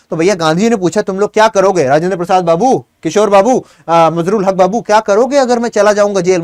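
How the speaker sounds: normal talking voice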